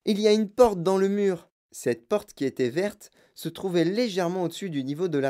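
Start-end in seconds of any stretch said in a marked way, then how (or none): 0.0-1.5 put-on higher, eager boy's voice; Charlie exclaiming. A narrator's reading. Boy showing grandpa a door, exclaiming. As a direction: announce a discovery, pitched up. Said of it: "s'écria Charlie".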